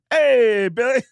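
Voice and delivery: mock douchey hey, loud and high; douchey greeting. Fan greeting [name] backstage, douchey. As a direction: imitate an overeager douche calling out. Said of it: "delivery that you give that makes me sound so douchey".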